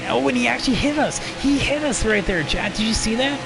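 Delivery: sudden high, fast outburst; jolted up in pitch at the hit; surprised. Real, not acted. Telling chat he just got hit, high and fast surprise.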